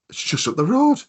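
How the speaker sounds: high, loud Durham-lad exclamation; imitated surprised lad. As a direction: exclaim it like an amazed local. Acting the lad's surprised reply.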